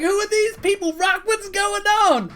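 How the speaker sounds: high-pitched excited calling; shouting crowd. Acted. Rock's followers asking about the strangers, high shouting. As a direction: call out high like curious followers. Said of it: "and shouting, like".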